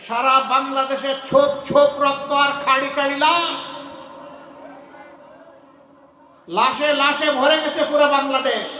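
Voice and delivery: loud, emphatic public address; impassioned oratory. Real, not acted. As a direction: deliver it forcefully to a rally crowd. Impassioned political speech to a crowd.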